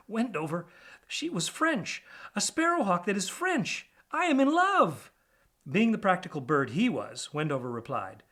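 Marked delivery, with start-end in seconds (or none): 0.1-5.1 high, dreamy smitten pack rat; lovestruck rat. Acted. DJ tells Wendover he is in love, high and dreamy. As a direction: swoon over the French hawk.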